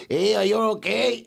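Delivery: lightly voiced rescuer checking in; imitating the helper. Imitating the man who opened the car door. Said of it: "He's like".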